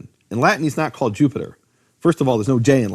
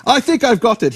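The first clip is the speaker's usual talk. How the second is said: Sherlock Holmes quote; 9 semitones higher than usual. Retold or imitated. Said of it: "where Sherlock Holmes says".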